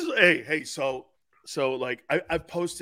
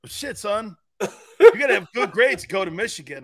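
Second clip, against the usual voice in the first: loud coach; 5 semitones higher, 7 dB louder than usual. Retold or imitated. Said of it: "He goes".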